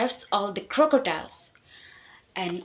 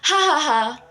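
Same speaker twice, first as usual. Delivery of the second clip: mocking ha ha ha, a bit higher; loud laugh; 6 semitones higher, 7 dB louder than usual. A narrator's reading. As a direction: laugh out loud at the fooled crocodile. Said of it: "the mouse deer laughed very loudly".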